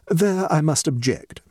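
stately, drawn-out demurral; measured objection. A narrator's reading. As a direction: object with deliberate dignity. Guest objects to first names.

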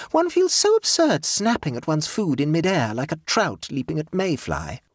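a hint of a pleased, scatterbrained aunt; light, self-satisfied chatter; gaily. A narrator's reading. Jane's voice.

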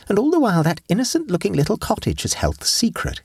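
put-on high, light young girl's voice; girlish Vera voice. Vera tells her aunt about Betsy, girlish voice. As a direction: lift into a teenage girl.

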